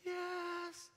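high, squeaky, soft praying voice; chipmunk noise. Retold or imitated. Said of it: "What is that chipmunk noise?".

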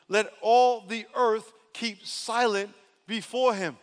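slow, emphatic proclamation; scripture proclamation. Acted. Preacher voicing God's word to the congregation, solemn proclamation. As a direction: proclaim each phrase with weight, slowly.

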